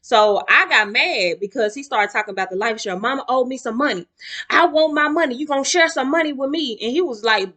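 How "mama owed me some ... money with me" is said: higher, louder pushy demand. Retold or imitated. Brother pushing his sister for insurance money, demanding. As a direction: press hard for the money.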